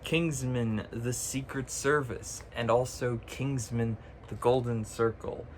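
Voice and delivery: usual speaking voice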